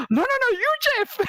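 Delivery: very high-pitched goblin denial; accusing goblin. Retold or imitated. Other goblin denies being Jeff, high accusing goblin voice. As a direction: throw the blame back as a high goblin. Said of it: "And the other goblin goes".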